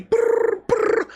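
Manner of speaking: high trilled brr like a ringing phone; mock ringtone brr; phone ring imitation.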